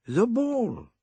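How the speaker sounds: high, surprised cry; startled exclaim. A narrator's reading. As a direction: blurt it out. Poirot exclaims.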